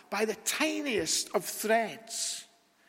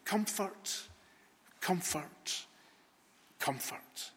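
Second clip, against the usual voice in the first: hushed call; 9 dB quieter, slower than usual. Acted. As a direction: comfort fading from soft to a breath. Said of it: "God cries out".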